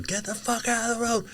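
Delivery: high mock road-rage yell; hammed-up angry driver's shout; retold yelling. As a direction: imitate an angry driver, high but held back in volume. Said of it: "and then started yelling".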